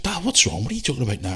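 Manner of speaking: high put-on kid's voice; imitating daughter. Daughter asking dad what's wrong, high imitated voice.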